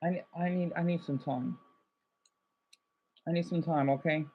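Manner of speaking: very soft, withdrawn request; subdued plea. Real, not acted. Streamer asking viewers for some time, subdued. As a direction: ask for time in a small, low-energy voice.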